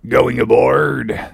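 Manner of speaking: slow, drawn-out character voice; odd stranger's voice. Acted. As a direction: stretch it out like a cracked old prophet. Elijah's question, put-on drawn-out voice.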